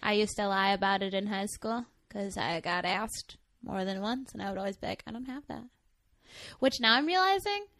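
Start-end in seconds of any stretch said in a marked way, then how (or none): 5.1-5.6 quietly insisting, a little softer; retold mumbled denial. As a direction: play it down quietly. Retold high school denial.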